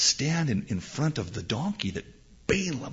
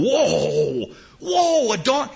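startled whoa; 11 semitones higher, 5 dB louder, much slower than usual. Acted. Balaam startled by donkey.

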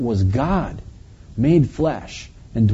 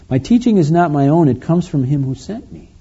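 raised, projected reading of an answer; scripture reading; 5 semitones higher, 6 dB louder than usual. Acted. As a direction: proclaim the verse, higher and louder. Reads Jesus' answer aloud.

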